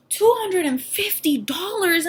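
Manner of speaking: aghast at the cost; shocked disbelief. Retold or imitated. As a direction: echo the price back in disbelief. Shocked at ultrasound price.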